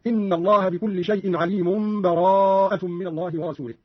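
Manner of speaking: slow recitation running عليم straight into براءة; joined without pause. Shown as a demonstration. Teacher demonstrates wasl, عليم flowing unbroken into براءة. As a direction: link عليم to براءة in one breath, no break. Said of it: "أو الوصل".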